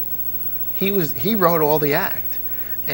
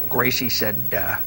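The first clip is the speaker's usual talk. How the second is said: lightly voiced old man, lower and softer; a hint of a dry old comic; old George Burns; 5 semitones lower, 3 dB quieter than usual. Guest retells George Burns's joke, a hint of old man. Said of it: "He said".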